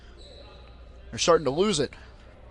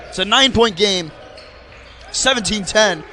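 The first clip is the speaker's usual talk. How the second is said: brisk live sports call; play-by-play commentary; 3 dB louder than usual. Acted. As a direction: call the game as it happens.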